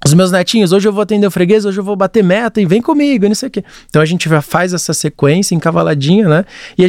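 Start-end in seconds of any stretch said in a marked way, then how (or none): 0.1-3.2 chatty, higher voice, phrases crowding each other with no gaps; run-together narration. Retold or imitated. Producer mimics granddad's voiceover to netinhos, run-together patter. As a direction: imitate the granddad's nonstop higher-pitched video patter. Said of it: "é sempre encavaladinho o áudio e o vô falando".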